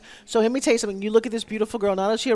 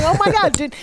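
mock wow; 8 semitones higher than usual. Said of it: "You're going".

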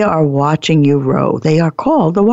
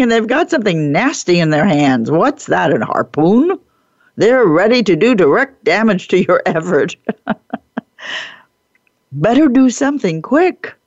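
usual speaking voice

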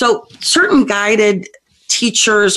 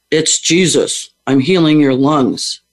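low man's voice; 3 semitones lower, slower than usual. Retold or imitated. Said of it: "this really low man's voice go".

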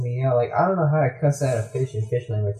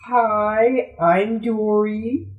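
higher, slow, drawn-out friendly hello; Dory; 8 semitones higher, much slower than usual. Retold or imitated. Dory introducing herself to the sharks, higher and slow. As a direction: stretch out the introduction sweetly. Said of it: "And so Dory comes up".

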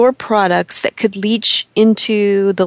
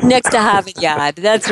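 put-on Boston accent. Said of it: "my Boston accent".

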